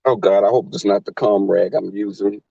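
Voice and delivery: ordinary talk, nothing marked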